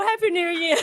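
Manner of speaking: ordinary talk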